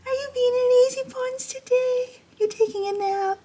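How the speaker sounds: very high, cooing pet-talk voice. Real, not acted. Greeting her dog Bella on camera, high cooing baby talk.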